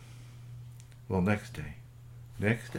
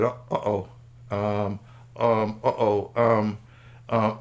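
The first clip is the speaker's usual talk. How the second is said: halting ums that grow louder; flustered stalling; 5 dB louder, slower than usual. Acted. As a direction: stall while hunting for an excuse. Goodlight caught out, stalls for excuse.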